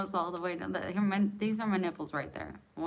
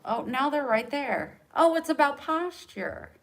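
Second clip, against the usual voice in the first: mock surprise; 8 semitones higher, 8 dB louder than usual. Mock delight at posture fix.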